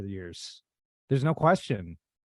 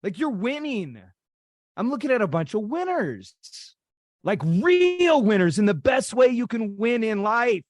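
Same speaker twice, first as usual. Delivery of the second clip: building to a high-pitched, impassioned peak; rousing tribute; 7 semitones higher than usual. Real, not acted. Speaker hails colleagues as winners, rousing and impassioned. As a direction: lift the pitch and stretch the words as you rally them.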